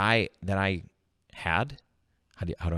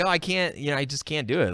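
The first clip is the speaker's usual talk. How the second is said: mock whiny self; 6 semitones higher than usual. Mocking his old fearful self.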